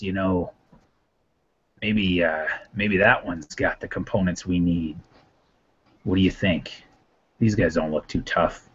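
low, quiet villain voice; murmured low, sly in-character aside; conspiring aside. Acted. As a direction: size them up quietly to companions. Villain confers aside with his companions.

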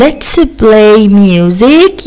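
slow, word by word; slow reading. Shown as a demonstration. Teacher reading the title slowly for pupils. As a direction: read it slowly. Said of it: "reading the unit".

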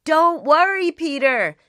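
loud reassuring call; shouted back. A narrator's reading. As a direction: call back up the hill to him. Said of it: "they shouted back".

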